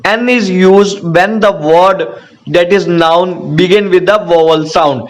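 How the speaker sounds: very slow, word-by-word rule reading; slow dictation. Shown as a demonstration. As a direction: dictate the rule so students can write it down. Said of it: "teacher dictates the rule".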